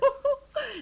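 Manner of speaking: a high, bright burst of laughter. Acted. Wife laughing at her husband's joke, high burst of laughter.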